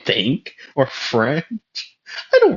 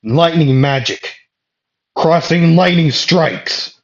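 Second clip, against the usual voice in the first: spell shout; 7 semitones higher, 5 dB louder than usual. Acted. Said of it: "And he would say".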